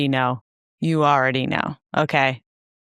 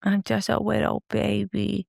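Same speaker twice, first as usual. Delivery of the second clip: put-on softer, higher lisping "wittle baby"; 5 semitones higher, 5 dB quieter than usual. Echoing her co-host's baby voice demo, softly in baby talk. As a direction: coo it sweetly like a toddler. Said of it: "a baby voice".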